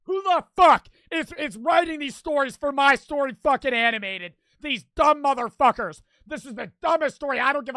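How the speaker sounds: loud, exasperated yelling at the writers; angry rant. Real, not acted. Fed up with the cartoon's writers, loud angry rant. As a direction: blow up at whoever wrote this.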